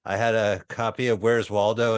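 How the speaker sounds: ordinary talk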